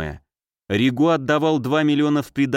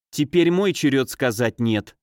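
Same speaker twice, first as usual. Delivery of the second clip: plain reading voice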